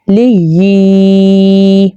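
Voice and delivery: لی gliding into a long yee; actual pronunciation. Shown as a demonstration. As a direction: hold a clean doubled y after لی. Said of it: "یہ ہے actual pronunciation".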